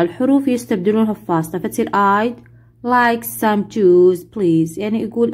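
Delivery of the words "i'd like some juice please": slow, careful passenger reply; very slow model reading. Shown as a demonstration. Teacher playing the passenger, slow model answer. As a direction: lay out every word for repeating. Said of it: "والثاني هو المسافر".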